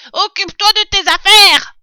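high, furious rat yell; vociferating rat. A narrator's reading. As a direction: refuse loudly in a piercing little voice. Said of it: "vociféra le rat". (rat qui vocifère, aigu et furieux)

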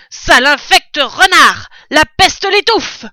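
insultes hurlées, plus aigu et bien plus fort; cri furieux. Acted. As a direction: crache sa rage contre le renard. Fermier furieux insulte le renard. (il hurle sa colère au renard)

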